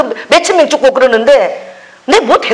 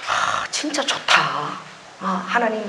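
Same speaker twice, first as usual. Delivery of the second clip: slow, sighing whisper of delight; 3 semitones lower, 11 dB quieter, slower than usual. Real, not acted. Pastor savoring her freedom before the congregation, slow whisper. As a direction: breathe it out slowly, soft and content.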